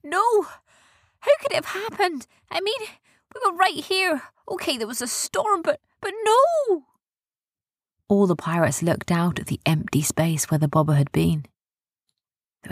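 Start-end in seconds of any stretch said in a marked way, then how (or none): 0.0-6.8 high-pitched disbelief, drawing out the last no; distraught. A narrator's reading. Erin protests that they were right here, high and distraught. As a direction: plead in a high, upset voice.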